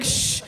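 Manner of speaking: imitated shushing hiss. Seatmate quieting a drunk passenger, imitated shush. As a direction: shush a noisy neighbour, softly.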